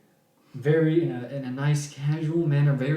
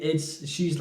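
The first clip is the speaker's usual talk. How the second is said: usual speaking voice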